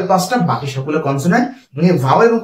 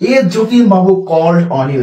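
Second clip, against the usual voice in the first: slow dictation; 2 semitones higher, much slower than usual. Shown as a demonstration. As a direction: read the example sentence word by word. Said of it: "দেখো".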